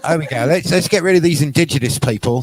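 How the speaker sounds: hammed-up English settler accent; mock English accent. Playing settlers fresh off the boat, mock English accent. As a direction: put on a rough English accent. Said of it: "people from England came here".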